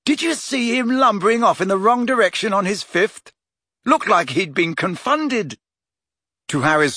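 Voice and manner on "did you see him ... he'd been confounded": high, gleeful gloating; highly satisfied. A narrator's reading. Ron gloats about McLaggen's miss, highly satisfied. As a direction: crow over the rival's blunder, pitch well up. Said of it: "in a highly satisfied voice".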